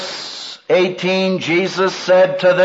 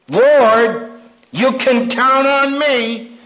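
slow, earnest vow of loyalty, pitched up; Peter's pledge; 8 semitones higher, much slower than usual. Retold or imitated. Preacher voicing Peter's vow to the Lord, slow and earnest. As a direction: promise it slowly and proudly. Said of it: "That's what Peter said".

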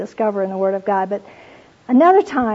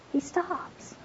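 hushed emphasis; 7 semitones higher, 14 dB quieter than usual. Acted.

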